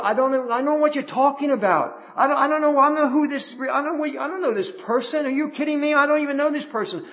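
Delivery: high, flustered protest of innocence; panicked denial. Acted. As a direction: deny it in a rising panic. Preacher acts out Peter's panicked denial.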